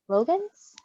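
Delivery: ordinary delivery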